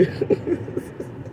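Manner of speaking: soft breathy laugh held back off mic; quiet snicker at the absurdity; stifled laugh. Real, not acted. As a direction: try to swallow a laugh and fail. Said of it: "I didn't mean to laugh".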